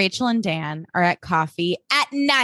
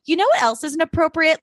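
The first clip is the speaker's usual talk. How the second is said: sarcastic retort; 8 semitones higher than usual. Retold or imitated. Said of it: "And I'm like".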